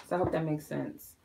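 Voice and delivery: ordinary talk, nothing marked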